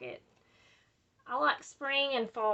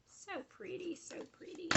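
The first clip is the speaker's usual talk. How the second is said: soft breathy whisper, higher; admiring whisper; 8 semitones higher, 13 dB quieter than usual. Real, not acted. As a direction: whisper it in delight at the paper. Admiring paper softly.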